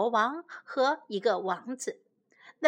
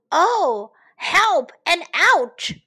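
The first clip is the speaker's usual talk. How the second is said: put-on slow, louder, higher wail in an old king's voice; hammed-up cry of pain; 5 semitones higher, 7 dB louder, slower than usual. Lost old king crying help and ouch in mock pain. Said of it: "cried the king".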